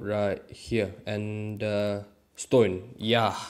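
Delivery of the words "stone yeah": ordinary delivery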